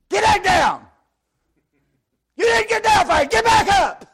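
screaming high drill instructor; drill sergeant shout. Retold or imitated. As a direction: scream orders at the private. Drill sergeant yelling at recruit.

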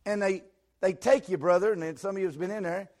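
loud, high preaching shout; shouting preacher. Real, not acted. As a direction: preach it at full force. Preacher building to full force.

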